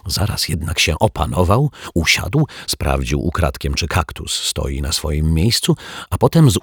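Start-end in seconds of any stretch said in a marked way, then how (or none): none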